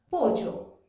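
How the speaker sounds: POLLO with a firm Y in place of LL; jungle pronunciation. Shown as a demonstration. Teacher showing how someone from the selva speaks, jungle yeísmo. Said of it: "Una persona que pertenece a la selva pronuncia".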